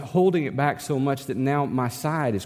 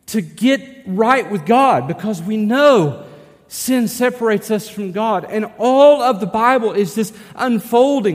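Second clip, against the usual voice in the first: full-force preaching; 7 semitones higher, 6 dB louder than usual. Acted. Pastor proclaiming Christ to his congregation, full-force preaching. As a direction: preach it out to the whole room, building on each "better".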